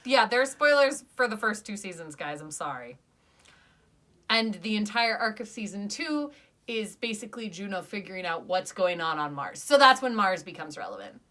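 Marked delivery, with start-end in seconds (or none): none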